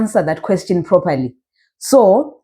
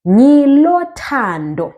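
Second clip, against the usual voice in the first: "ngilo thando" slow, higher, drawn out; slow teacher's demo of "ngilo thando"; model pronunciation; 5 semitones higher, slower than usual. Shown as a demonstration. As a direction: stretch "ngilo thando" with raised pitch. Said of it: "you are now saying ngilo".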